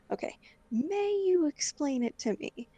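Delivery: irritated correction, leaning on "may"; pissed off. Retold or imitated. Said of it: "I was pissed off at that point".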